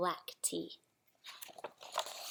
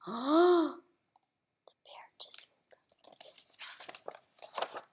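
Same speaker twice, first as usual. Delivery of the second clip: hushed storytelling whisper; 4 semitones higher, 6 dB quieter than usual. A narrator's reading. Reading to a child, hushed whisper. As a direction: whisper it so the bear stays asleep. Suspense after sneeze.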